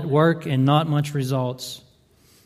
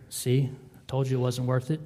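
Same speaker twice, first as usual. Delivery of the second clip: softer, taunting murmur in the ear; a hushed taunt, voiced by the enemy; enemy whispering; 8 dB quieter than usual. The enemy taunting a weary parent, softly murmured. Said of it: "the enemy whispers in your ear".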